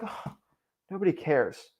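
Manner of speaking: soft, sinking "Oh, nobody cares" like giving up; deflated. Shown as a demonstration. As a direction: say "nobody cares" as bad news, sighing and quiet. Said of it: "It's the same sentence, but people can put it either one way or the other".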